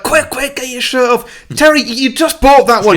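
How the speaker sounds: high, loud, frantic film impression; urgent mock shouting. Acting out a werewolf scene to the co-host, urgent mock shouting.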